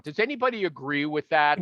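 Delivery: ordinary talk, nothing marked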